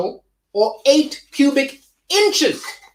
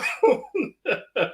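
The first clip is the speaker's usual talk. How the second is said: quiet giggle; a short, soft laugh; 3 semitones higher, 5 dB quieter than usual. Real, not acted. Laughs at the chat.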